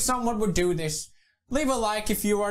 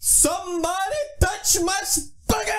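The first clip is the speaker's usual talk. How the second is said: mock boast shout; 6 semitones higher than usual. Boastful hammed-up villain rant.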